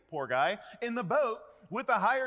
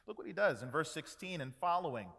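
normal talking voice

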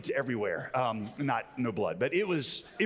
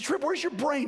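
angry scolding; 12 semitones higher than usual. Retold or imitated. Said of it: "I said".

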